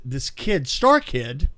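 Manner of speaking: ordinary talk, nothing marked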